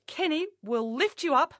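usual speaking voice

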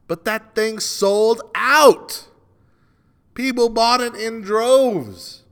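loud, high, drawn-out outrage; indignant outburst. Real, not acted. Host outraged that a pricey T-shirt sold out, indignant outburst. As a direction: cry it out slowly in disbelief. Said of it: "I'm so mad".

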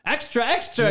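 high-pitched newsboy calling out headlines; old-timey newsboy. Pretend. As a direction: hawk the papers like a street newsboy. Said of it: "the old-timey newsboy voice".

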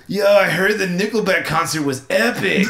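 laid-back surfer dude reading. Shown as a demonstration. Host reading a game card as a light surfer dude. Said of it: "And my accent was surfer".

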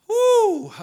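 high, loud whoo; exultant whoop. Real, not acted. Preacher overcome by faith in God, exultant whoop. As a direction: let out a sudden ringing whoop.

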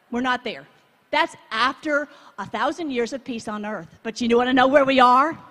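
usual speaking voice

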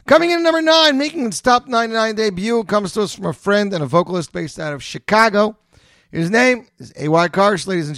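high, loud countdown announcer; top ten countdown. Acted. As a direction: announce it like a big reveal.

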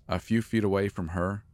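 ordinary delivery

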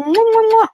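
high, loud blown kisses; kissing noises. Real, not acted. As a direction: blow big air kisses goodbye. Blowing kisses at show end.

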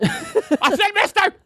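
very high, slow squeak; high squeaky voice. Pretend. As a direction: whine it up high and drawn out. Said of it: "you get hung up on the smallest things".

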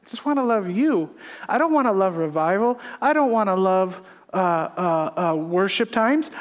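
ordinary talk, nothing marked

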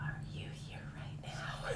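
hushed whisper to the spirits. Real, not acted. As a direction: breathe the question softly. Asking the spirits in a hush.

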